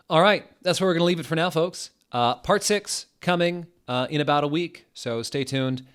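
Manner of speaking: cheery youtuber sign-off; upbeat sign-off. Real, not acted. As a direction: wrap up the video briskly. Outro to viewers.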